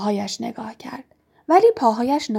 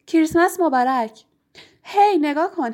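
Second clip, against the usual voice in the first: high-pitched festive call; excited greeting; 7 semitones higher, 3 dB louder than usual. A narrator's reading. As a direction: burst in with a cheery Christmas greeting. Twins burst in with Christmas greeting.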